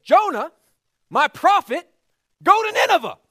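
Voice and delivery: high, loud commanding God; booming God voice. Acted. God commanding his prophet Jonah, booming.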